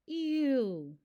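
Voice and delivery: grossed-out ew; disgusted. Acted. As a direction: react with disgust. Disgusted at the burp.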